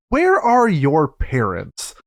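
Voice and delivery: drawn-out, word-by-word mock exasperation; exasperated question. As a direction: ask it very slowly, stressing each word. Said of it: "where it's like".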